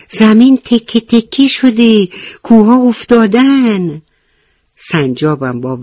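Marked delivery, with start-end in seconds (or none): 0.1-4.0 higher, louder terrified cry; panicked rabbit. Acted. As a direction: blurt it out in panic while running. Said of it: "با وحشت گفت". (با وحشت و فریاد)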